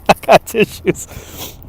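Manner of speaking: whispered through a laugh, slow; giggling whisper. Real, not acted. Joking about crying.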